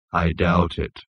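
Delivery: gloomy robot voice, resigned; Marvin. Acted. Gloomy robot replies to the receptionist, dejected. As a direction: answer without any hope. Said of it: "said Marvin".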